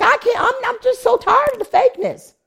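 very high, strained-with-frustration outburst; high-pitched exasperated plea. Retold or imitated. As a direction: act out being utterly fed up. Own exasperation acted out.

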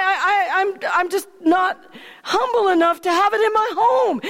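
high, nervous stammering with little laughs; flustered stammer. Acted. Obed Edom stammering nervously to the king at his door. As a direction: babble and trip over the words, overwhelmed.

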